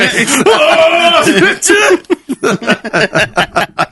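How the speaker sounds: howling laughter. Real, not acted. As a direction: let the laugh run on and on. Laughs at his own story.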